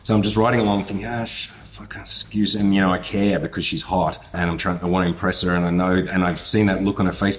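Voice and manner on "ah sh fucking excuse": whispered panic. Retold or imitated. Panicking on bike over excuse.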